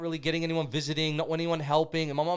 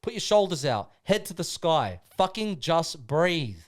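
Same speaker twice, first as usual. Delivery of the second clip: louder, chest-out battle cry; rallying speech; 4 semitones higher, 7 dB louder than usual. Acted. Rousing the brothers with a loud, proud war speech. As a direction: pump up the crew like a war chief.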